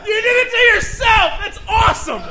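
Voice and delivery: high cheering shout; excited shout. Real, not acted. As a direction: cheer him on wildly. Cheering contestant on.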